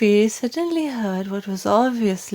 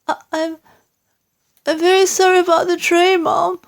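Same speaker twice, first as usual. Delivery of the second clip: flustered maid; 10 semitones higher, 4 dB louder, faster than usual. A narrator's reading.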